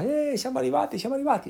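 high, cheery mock excitement; excited arrival. Imitating happy Italians just landed in London, excited. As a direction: chirp it like a thrilled newcomer.

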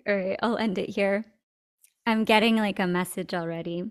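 normal talking voice

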